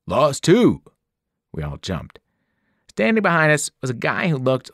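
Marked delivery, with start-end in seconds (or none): 0.1-0.8 booming. A narrator's reading. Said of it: "A voice behind us boomed".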